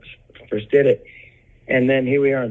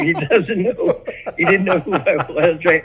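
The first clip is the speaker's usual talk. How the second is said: ordinary talk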